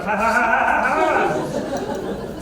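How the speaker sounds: high, soft, drawn-out fake laugh; slow mock cackle trailing off; imitated delayed laughter. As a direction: mimic someone laughing alone on the way home. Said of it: "They're like".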